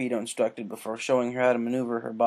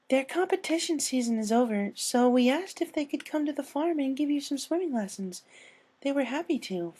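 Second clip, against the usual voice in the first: gentle Fluttershy. A narrator's reading. Fluttershy explains the swimming lessons to Rainbow, shy and high. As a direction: lift into a timid pony's voice.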